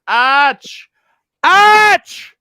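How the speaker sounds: escalating high-pitched shout; shouted name. Acted. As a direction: yell the name as if calling across a street. Reading out viewer's exclaimed message.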